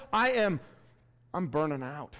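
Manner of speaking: ordinary delivery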